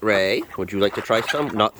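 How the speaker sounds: normal talking voice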